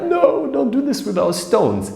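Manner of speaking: high-pitched mock wail of upset children; tearful kids' protest. Kids whose stones got kicked away, mock-crying protest. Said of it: "And the kids start crying. They're like".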